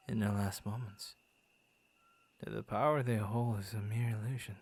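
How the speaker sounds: ordinary talk, nothing marked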